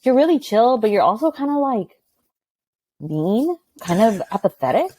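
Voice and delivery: light high student impression; teasing students. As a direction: imitate a kid giving a cheeky verdict. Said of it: "the kids ... will be like".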